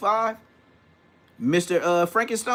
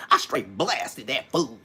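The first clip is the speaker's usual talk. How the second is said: slow, lowered OG voice, drawing out "blasted"; put-on rapper talk; 5 semitones lower, slower than usual. Mocking big homies who talk like rappers, slow put-on drawl. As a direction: put it on like an old-school rapper. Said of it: "they put it on like the rapper".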